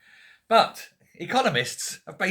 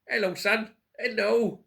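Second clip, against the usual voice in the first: higher, fond greeting to a pet; talking to the dog Barnsley Bear, higher and fond; 7 semitones higher, slower than usual. Real, not acted. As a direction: coo hello up at the dog.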